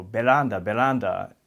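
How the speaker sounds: "Veranda, veranda" as be-lan-da, with an R that sounds like L; Japanese-style veranda. Retold or imitated. A child at school repeating "veranda" the parents' way. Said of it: "go to school and go".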